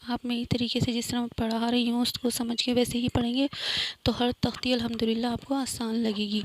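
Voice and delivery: ordinary delivery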